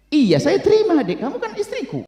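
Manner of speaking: acted high-pitched insistence; emphatic reassurance. Husband insists he accepts his wife, high and emphatic.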